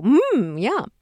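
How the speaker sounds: high, swooping yummy hum; happy, delighted. Acted. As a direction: savor it with exaggerated delight. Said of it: "she'd make a happy face".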